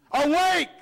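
high, ringing awake; a preacher's sudden high call; ringing cry. Acted. Preacher reads scripture, exclaiming.

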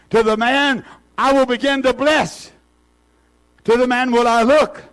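slow, declaimed verse with long pauses; emphatic scripture reading. Real, not acted. Preacher reading Isaiah to the congregation, emphatic scripture reading. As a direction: build to a high, ringing proclamation.

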